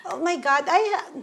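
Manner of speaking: ordinary talk, nothing marked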